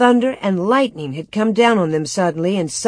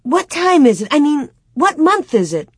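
high-pitched, confused asking; bewildered question; 8 semitones higher, 4 dB louder than usual. A narrator's reading. Girl asking her mother the month, bewildered. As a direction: ask it as if dazed, correcting yourself. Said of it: "Laura asked stupidly".

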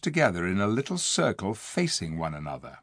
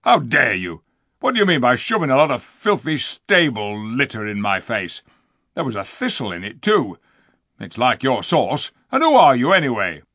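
loud, rough furious dwarf; 5 dB louder than usual. Acted. Diggle raging about the flowers in his face, loud and rough. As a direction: rant at her in outrage.

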